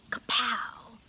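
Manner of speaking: gleeful whispered Kapow; whispered triumph. Acted. Boy silently cheering his trick, whispered. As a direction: hiss it as a secret win.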